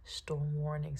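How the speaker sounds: ordinary talk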